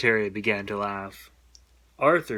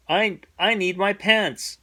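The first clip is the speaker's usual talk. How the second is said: higher, slower, halting plea; stammering plea; 8 semitones higher than usual. A narrator's reading. Amoeba Arthur pleading for his pants. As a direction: beg shakily for help.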